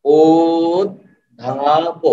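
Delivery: ও, ধা, প spoken as separate slow beats; slow syllable by syllable. Shown as a demonstration. Teacher demonstrating slow pronunciation to students, syllable by syllable. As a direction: sound out each syllable with pauses. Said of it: "আস্তে আস্তে উচ্চারণ করেন".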